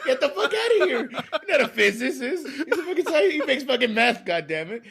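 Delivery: high-pitched, laughing indignation; mock outrage. Co-host mocking his friend's defence of the show, playfully outraged. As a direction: scoff in exaggerated disbelief, voice shooting up.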